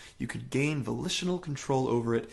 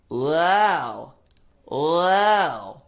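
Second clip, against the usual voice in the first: higher, louder whiny wow; bratty whiny voice; 4 semitones higher, 9 dB louder than usual. Shown as a demonstration. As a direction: raise the larynx and whine. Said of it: "But as I raise that larynx".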